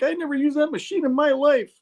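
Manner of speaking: high-pitched comic protest; hammed-up falsetto reasoning; Peter pleading to reason. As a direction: plead innocence in a squeaky mock voice. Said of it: "trying to reason her".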